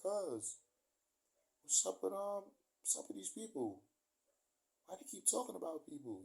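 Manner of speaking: high-pitched retold questioning; high, incredulous aside to a relative; imitating puzzled questioning. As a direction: ask your cousin, baffled, pitched well up.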